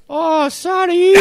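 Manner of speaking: high, loud, drawn-out singsong sorry; mimicked neighbor apology. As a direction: call it sheepishly through a wall, hammed-up. Imitating neighbor's apology through wall.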